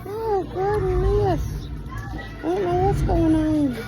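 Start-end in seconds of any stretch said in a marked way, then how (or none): none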